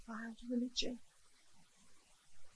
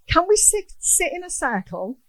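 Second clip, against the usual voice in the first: son's eager question; 7 semitones higher, 7 dB louder than usual. Retold or imitated. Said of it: "He said".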